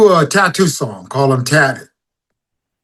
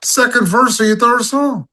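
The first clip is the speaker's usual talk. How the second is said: scolding inner voice; 6 semitones higher than usual. Retold or imitated. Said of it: "It's like".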